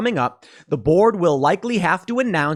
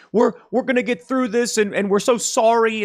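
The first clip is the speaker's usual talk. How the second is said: higher, hammed-up sympathetic boss voice; mock consoling CEO; 7 semitones higher than usual. CEO mock-soothing upset employees, hammed-up sympathy. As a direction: play the soothing executive, mockingly.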